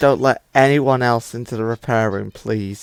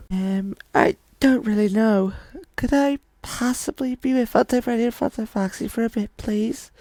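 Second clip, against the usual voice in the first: put-on high, shy boy voice asking politely; a timid kid hoping for a yes; voiced young boy; 9 semitones higher, 3 dB quieter than usual. Ryan asking Leonard, shy and hopeful. Boy asks to stay with friends.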